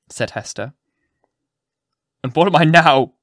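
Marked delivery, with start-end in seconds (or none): 2.2-3.1 loud, raised challenge; fierce demand. A narrator's reading. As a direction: demand it forcefully, pitch lifted. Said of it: "demanded he".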